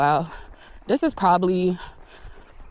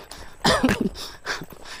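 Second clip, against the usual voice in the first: high, breathy cackle trailing off; wheezing laugh; 4 dB quieter than usual. Real, not acted. Parent amused by own child, wheezing laugh. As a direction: laugh until it runs out of air. Cracking up after mock praise.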